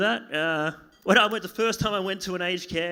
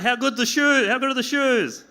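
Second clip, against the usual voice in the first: higher, hammed-up gushing over shoes; mock admiration; 7 semitones higher, 4 dB louder than usual. Comic mocking the audience for prizing shoes, mock admiration. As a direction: gush like someone with skewed priorities.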